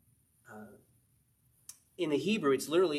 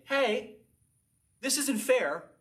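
higher, louder protest of the unfairness; indignant complaint; 9 semitones higher, 4 dB louder, much slower than usual. Retold or imitated. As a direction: whine like someone cheated at judgment. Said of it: "and you say".

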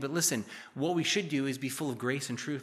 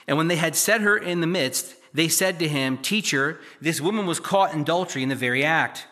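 louder, measured Bible reading voice; scripture reading; 6 dB louder than usual. Acted. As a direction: read the passage out to the congregation. Reads the scripture passage aloud.

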